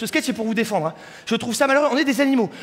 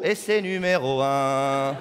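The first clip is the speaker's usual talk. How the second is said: very low, slowed-down announcer boom; mock radio host intro; deep slow announcement; 10 semitones lower, much slower than usual. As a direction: drop the voice deep and drag it out.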